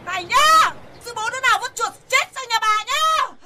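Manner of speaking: loud, high scolding at the whole street; furious old woman berating the neighbours; angry yelling. Acted. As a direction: shout insults at whoever dumped it, fast at the end. Wife yells at unknown neighbours.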